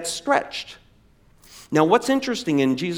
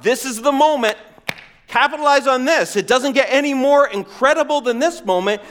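urgent pleading; 7 semitones higher than usual. Acted. Urging Jesus to capitalize.

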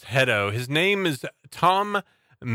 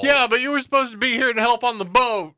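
high, loud, fast complaint; whiny friend; 10 semitones higher, 4 dB louder, faster than usual. Pretend.

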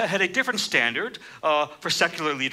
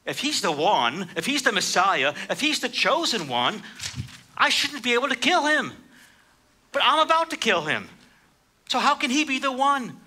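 high, mocking bad-guy voice; villain about to kill the hero, sneering; gloating villain; 9 semitones higher than usual. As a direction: taunt like a smug movie villain. Movie villain gloating over Neo.

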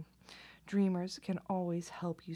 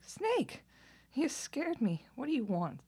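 startled cry; 8 semitones higher, slower than usual. Acted. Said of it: "Lizard cried".

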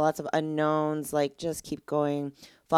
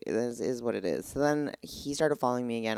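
ordinary delivery

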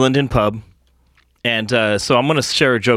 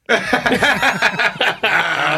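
laughter; 10 semitones higher than usual. Real, not acted. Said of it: "Oh, here we go".

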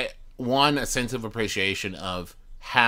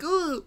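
high-pitched mock wince noise; cringing groan. Listener hearing a dated joke today, mock cringing. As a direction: let out a small, squeaky mock cringe. Mimics wincing at dated jokes.

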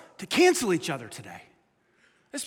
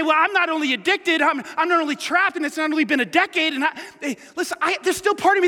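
higher, louder pleading objection; protesting sinner; 7 semitones higher, 3 dB louder than usual. Retold or imitated. Pastor voicing a sinner arguing with Jesus, pleading. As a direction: push back as the guilty listener.

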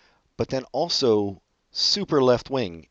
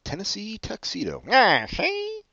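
squawky bouncy penguin; 12 semitones higher than usual. Pretend. Voicing the cartoon penguin.

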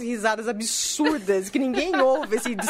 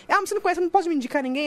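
voz bem mais aguda, pedido insistente; cliente insistente imitado; 6 semitones higher, much faster than usual. Retold or imitated. Cliente insistindo, citação recontada. (imitação de cliente pedindo ajuda)

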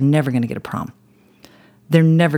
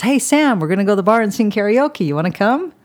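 coworker calling out; 8 semitones higher than usual. Retold or imitated. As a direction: call over to her like a friend inviting her out. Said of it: "and the voice says".

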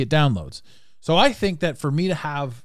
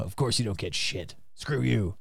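scornful listener; 5 semitones lower, 8 dB quieter than usual. Retold or imitated. Imagined listener mocking the host, low sneering voice. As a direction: lower and softer sneering mock. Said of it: "I could just picture a few of them".

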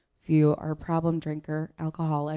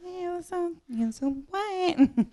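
perky hostess; 12 semitones higher, 5 dB quieter than usual. Retold or imitated. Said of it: "it's like".

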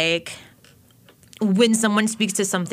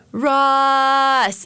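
name called out loud and high; 7 semitones higher, 5 dB louder than usual. Retold or imitated. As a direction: call the name out with a falling tune. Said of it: "sometimes I say".

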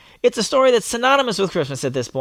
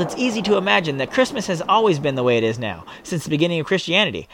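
ordinary delivery

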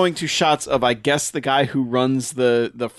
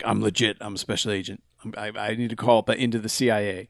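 low, soft, quick reassurance; hushed insistence; 5 semitones lower, 6 dB quieter, faster than usual. Retold or imitated. Ken Wall convincing the communications guy, low and hurried. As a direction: mutter it quickly to convince him. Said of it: "but he's like".

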